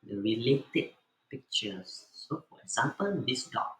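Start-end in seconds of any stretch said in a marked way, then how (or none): none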